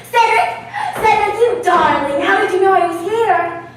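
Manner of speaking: high, loud gushing over a surprise call; thrilled actress gushing into the phone; delighted squeal. Acted. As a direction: squeal with joy at hearing him.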